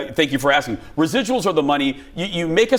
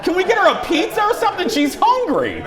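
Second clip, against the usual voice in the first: laughing; 10 semitones higher than usual. Real, not acted. Laughing about bus rider.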